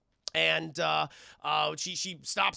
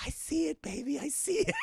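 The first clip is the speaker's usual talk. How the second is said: high, breathless excitement; mock excited dad; 6 semitones higher than usual. [name] watching the birth, high and excited. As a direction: marvel at the baby.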